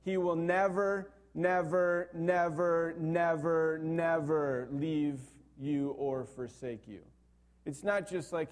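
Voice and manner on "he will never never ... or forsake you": NEVER hammered out over and over, high and loud, easing off at the end; emphatic reading. Shown as a demonstration. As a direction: punch each NEVER as its own beat. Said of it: "he says it so emphatically".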